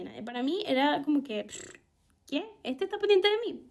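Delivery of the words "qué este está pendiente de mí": high, incredulous "what?"; mock disbelief. Teen girl scoffing that a boy likes her, mock disbelief. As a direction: play it as if the idea is absurd. Imagined disbelief at a boy's interest.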